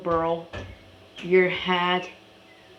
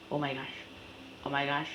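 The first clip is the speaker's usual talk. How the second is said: much softer, quick oh my gosh under the breath; muttered; 3 semitones lower, 12 dB quieter, much faster than usual. Real, not acted. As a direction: mumble it to yourself. Repeated exclamation, quiet.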